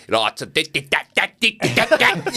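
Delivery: high, sharp mock South African chatter; mock South African accent. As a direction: caricature South African as a rising staccato of hard sounds. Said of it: "when you're South African, it's just like".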